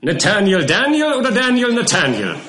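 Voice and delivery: high, loud mock confusion; mocking counsel. A narrator's reading. Counsel Skimpin ridicules the witness's name, high and mocking. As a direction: toy with the names mockingly.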